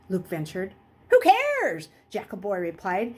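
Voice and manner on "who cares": high, loud scoff; careless shout. Acted. Jackal Boy brushing off Luke's worry with a shout.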